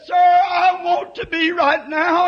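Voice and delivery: high, loud, slow plea; earnest plea. Retold or imitated. Injured woman answering the preacher, high pleading cry. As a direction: cry it out, desperate to be saved.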